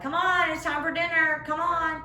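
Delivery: a mom hollering that dinner's ready; mom calling out. Retold or imitated. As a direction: call the kids in like a mom.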